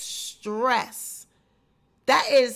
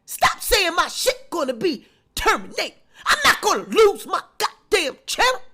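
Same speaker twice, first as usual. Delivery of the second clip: angry Tasha rant; 5 semitones higher than usual. Retold or imitated. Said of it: "that's when she said".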